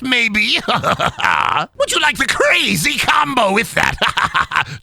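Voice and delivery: high manic villain salesman; wild theatrical pitch with cackles; Joker. Acted. Joker selling pizza to a customer, manic villain voice. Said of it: "Do the Joker selling us a Little Caesars pizza".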